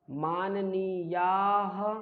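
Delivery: "माननीयाः" very drawn out, ending in "ha"; teacher's slow model of "माननीयाः"; visarga sounded as "ha". Shown as a demonstration. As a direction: stretch "माननीयाः" slowly and sound the final ha. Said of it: "हा का उच्चारण".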